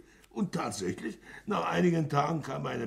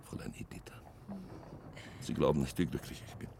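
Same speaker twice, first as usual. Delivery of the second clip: soft breathy whisper of her name; 11 semitones lower, 4 dB quieter, much faster than usual. Acted. Suitor whispering Editha's name, hushed and tender.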